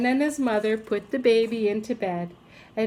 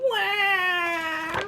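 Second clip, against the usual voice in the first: put-on high baby wail; baby yelling wah; 8 semitones higher than usual. A narrator's reading. Baby yells among the adults, wailing. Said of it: "the baby was yelling as loud as possible".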